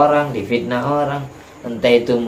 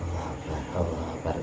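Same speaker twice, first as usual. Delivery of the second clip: much softer, lower, weak reply; faint dying answer; 6 semitones lower, 13 dB quieter, faster than usual. Retold or imitated. Dying man softly answering the preacher's adhan. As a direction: answer the call like a man at his last breath. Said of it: "itu jawab".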